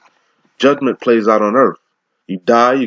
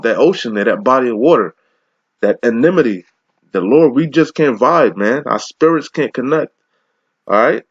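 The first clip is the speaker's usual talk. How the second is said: ordinary delivery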